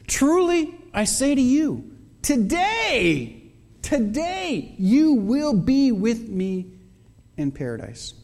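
voicing Jesus. Pastor voices Jesus's words.

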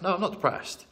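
imitated high-pitched protest; mock denial. Client denies depression to therapist, imitated high protest. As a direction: mimic a client brushing it off, pitch jumping up.

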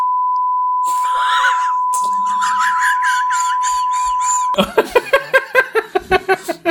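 high shrieking laughter. Real, not acted. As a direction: burst into a long shriek of laughter. Long outburst.

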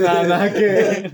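loud, high laughing protest. Real, not acted. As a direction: laugh out the denial. Laughing at friend's absurd joke.

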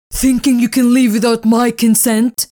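loud, menacing demand; furious owner. Acted. Owner grabbing Spirit, raging at her. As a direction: threaten her for defying you.

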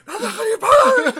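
very high, loud, drawn-out screech; mock furious yell. Friend acting out Patri shoving the lad, screeching. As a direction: screech it like a small furious attacker. Imitating small girl attacking the lad.

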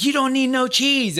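loud, high-pitched retold scolding; angry parental yell. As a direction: yell it at the kids, exasperated. Dad yelling at daughters, retold.

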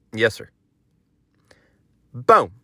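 usual speaking voice

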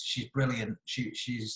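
ordinary talk

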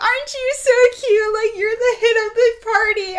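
put-on high, gushing drunk friend. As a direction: coo at her like a tipsy partygoer. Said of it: "she's like".

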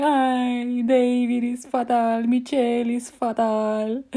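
put-on high, slow accented lament; hammed-up imitation of a panicking mother; mum's dramatic wail. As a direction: wail to the children as if dying. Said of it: "And she's like".

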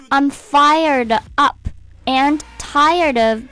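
1 slow lyric reading. Shown as a demonstration. Reads lyric line slowly.

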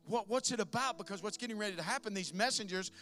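loud, building sermon climax; full-force preaching. Acted. Pastor driving home the message, loud full-force preaching. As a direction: hammer every line home to the church.

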